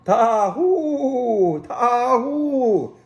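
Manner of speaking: high, loud long calling cry; shepherd's call. Acted. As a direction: call the flock to come and eat. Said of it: "Jabal called".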